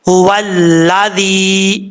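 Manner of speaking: हुवल्लाज़ी drawn out, very slow; slow recitation. Shown as a demonstration. Teacher reciting the phrase slowly in class. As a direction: recite हुवल्लाज़ी stretched and careful. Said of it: "हा हरूफ मुस्ताला में से अपुर पढ़ा जाएगा".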